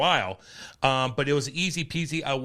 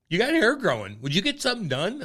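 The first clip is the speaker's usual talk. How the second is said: a hint of a chirpy stylist, higher; lightly voiced hairdresser girl; 7 semitones higher than usual. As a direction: raise the pitch for the hairdresser's question. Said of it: "the girl's like".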